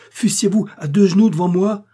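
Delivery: loud, high, defiant surge; impassioned vow. A narrator's reading. Impassioned vow never to marry her.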